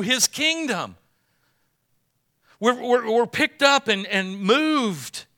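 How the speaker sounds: loud, high, fervent preaching; rousing pulpit delivery; preaching at full force. Acted. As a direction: lift the room, preach it out.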